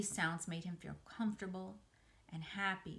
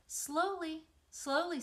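gentle slowing; 9 semitones higher, 5 dB louder, slower than usual. Acted. Spider calming racing pig.

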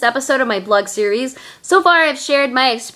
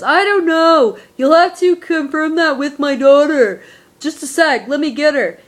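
imitated mom on the phone, higher voice; friend's mother; 5 semitones higher than usual. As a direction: put on a higher voice as the mother stalling.

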